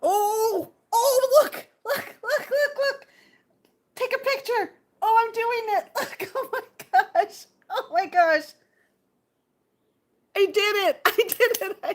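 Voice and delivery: high, loud squeals through laughter; beside yourself with excitement, very high and loud; real excited squealing. Real, not acted. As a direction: shriek with delight, laughing. Thrilled at snapping a picture.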